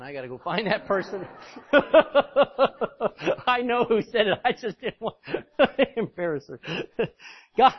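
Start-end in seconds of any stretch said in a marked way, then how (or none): 1.3-3.4 long, loud burst of laughter; real belly laugh, rolling on; hearty laugh. Real, not acted. Preacher cracking up at someone's amen, hearty laughing. Joke about who said amen. 3.5-7.7 real laughter breaking up the words; laughing while talking. Real, not acted. Preacher teasing a listener over an amen, laughing as he talks.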